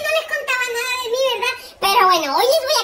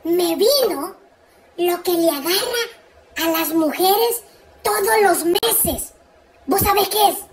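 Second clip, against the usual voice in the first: ordinary talk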